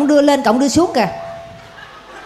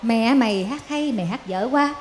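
much softer, just push the breath out lightly; nói nhẹ nhàng, đẩy hơi ra nhẹ; gentle soft delivery; 5 semitones lower, 10 dB quieter than usual. Shown as a demonstration. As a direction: say it gently, not like an insult. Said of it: "nhẹ, đẩy nhẹ cái hơi ra thôi".

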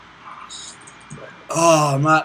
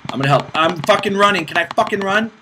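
loud, higher, frustrated rant at the screen; angry gamer yelling; 5 semitones higher, 7 dB louder than usual. Real, not acted. Gamer raging at his own game, loud and angry. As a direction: yell in frustration while clicking.

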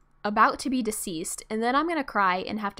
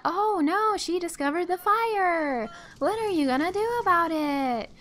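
mock alarm; 6 semitones higher than usual. Player teases neighbor who discovered the fire, mock alarm. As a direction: play up fake concern.

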